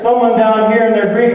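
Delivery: up on the rim. Shown as a demonstration. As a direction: lift grief high and loud, from up on the rim. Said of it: "it's much easier to be up here".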